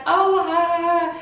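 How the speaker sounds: high, loud flustered exclamation; mock flustered. Friends fake an excuse to leave, loud flustered blurt. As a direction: blurt it out in a panic. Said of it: "And we were like".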